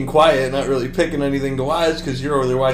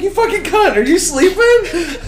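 mock angry yell; 8 dB louder than usual. Roommate yelling at his passed-out friend, laughing mock fury. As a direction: shriek it in playful mock outrage. Mocking sleeping roommate, exasperated.

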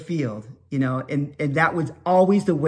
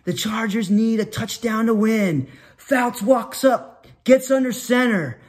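slow, higher-pitched sports announcer; announcer mode; 4 semitones higher than usual. Retold or imitated. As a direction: set up the big play for the crowd. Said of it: "I would go into announcer mode".

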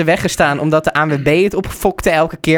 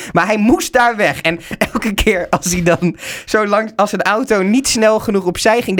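ordinary talk, nothing marked

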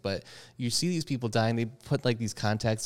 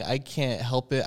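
ordinary delivery

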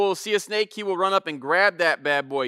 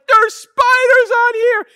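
put-on much higher and louder, a child shrieking in fear, slow; terrified kid; 9 dB louder, slower than usual. Scared son on the counterweight, shrieking about spiders. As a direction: wail it like a kid covered in spiders. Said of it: "He's like".

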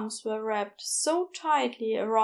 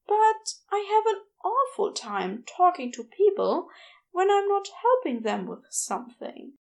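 higher, gentle fussy Aziraphale voice, embarrassed; flustered angel; 8 semitones higher than usual. A narrator's reading. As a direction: play a flustered, apologetic angel.

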